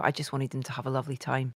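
ordinary delivery

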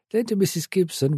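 plain reading voice

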